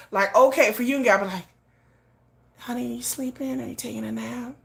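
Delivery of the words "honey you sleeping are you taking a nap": much softer, tender hushed coaxing; hushed sweet tones. Retold or imitated. Sweet hushed voice to her sleeping man Yoongi. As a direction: hush it down like tending a sleeping boyfriend. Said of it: "talking in hushed tones".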